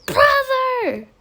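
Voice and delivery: high, loud call across the house. Acted. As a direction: shout for your brother. Calling brother from outside.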